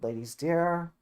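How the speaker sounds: normal talking voice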